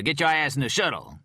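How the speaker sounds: loud high barked order; gruff order. Acted. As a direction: bark it at him like a cop. Cop barking an order.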